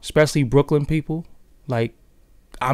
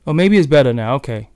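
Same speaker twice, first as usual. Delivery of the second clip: normal talking voice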